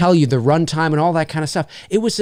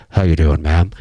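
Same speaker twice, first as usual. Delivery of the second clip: much lower, louder smooth greeting; mock suave greeting; 11 semitones lower, 4 dB louder than usual. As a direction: croon it into the mic like a lounge host. Mic greeting to lone girl.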